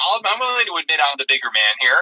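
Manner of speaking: usual speaking voice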